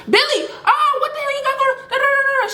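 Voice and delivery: very high-pitched imitation of a yelling mother; shrieky mock scolding, trailing into da-da-da; mock mom yelling. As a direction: yell it high like a mom scolding her son. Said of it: "She yelling at him like".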